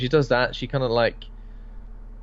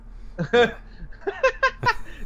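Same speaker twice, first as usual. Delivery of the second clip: high burst of laughter. Real, not acted. Laughing at cleaver joke.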